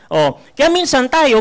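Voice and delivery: ordinary delivery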